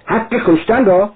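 a high, horrified gasp of a question; stunned cry. Acted. Sonya hearing his reasoning, a stunned high cry. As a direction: cry out in disbelief. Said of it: "سونیا بهتده فریاد زد".

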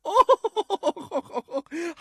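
put-on high, loud old-lady hoot; very high-pitched theatrical cackle; old lady's laugh. A narrator's reading. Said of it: "بانوی پیر باز خندید".

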